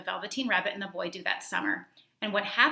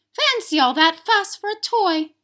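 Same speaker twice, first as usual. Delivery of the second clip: much higher, louder scoff; scoffing Nana; 12 semitones higher, 12 dB louder, slower than usual. A narrator's reading. Nana scoffs at the boy's fuss over a toy, high and loud. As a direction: scoff at the fuss like a cross nanny. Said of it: "she said".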